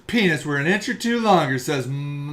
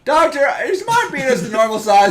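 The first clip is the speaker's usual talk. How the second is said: worried patient; 7 semitones higher than usual. Retold or imitated. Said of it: "walk into the doctor and be like".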